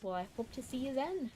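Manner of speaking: ordinary talk, nothing marked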